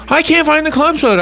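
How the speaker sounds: high-pitched mock exasperation; retold anger. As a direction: imitate a mad sleepwalker who can't find something. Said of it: "He was so mad".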